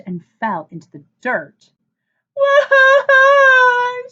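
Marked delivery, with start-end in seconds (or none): 2.3-4.1 put-on long, very high, loud childish wail; loud sobbing wail. A narrator's reading. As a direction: bawl like a small child who just fell. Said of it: "she sobbed".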